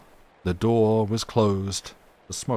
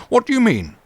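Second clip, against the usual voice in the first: much higher and louder, indignant; angry demand; 8 dB louder than usual. Acted. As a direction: demand an answer, rising from the table. Captain rising angrily from table.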